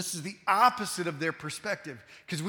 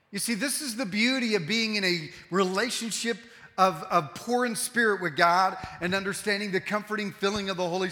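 raised, louder preaching voice, building; 6 semitones higher, 4 dB louder than usual. Acted. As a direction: preach it with full conviction. Preacher builds to full force.

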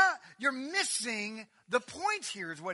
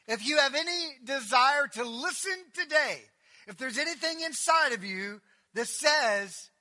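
preaching; 7 semitones higher, 10 dB louder than usual. Real, not acted. Preaching at full force.

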